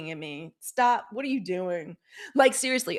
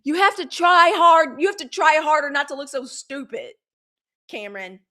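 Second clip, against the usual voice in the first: high, loud scolding yell; exasperated scolding aimed at Cam Newton; 7 semitones higher, 9 dB louder than usual. Retold or imitated. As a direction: tell him off hard and high. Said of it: "I'm like".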